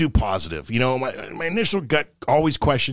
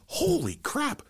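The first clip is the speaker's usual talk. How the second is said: much higher, softer exclaim; blind guy quote; 8 dB quieter, slower than usual. Acted. Joke teller voicing old blind friend, high surprise. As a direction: squeak out a surprised greeting. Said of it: "first guy goes".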